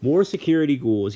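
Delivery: a loud, high-pitched moan of complaint; moaning Hades. A narrator's reading. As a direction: groan it like a god at the end of his rope. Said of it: "he moaned".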